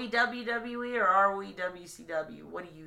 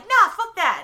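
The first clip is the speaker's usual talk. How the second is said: high, loud mock refusal; dismissive wrestlers; 11 semitones higher, 10 dB louder than usual. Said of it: "they're like".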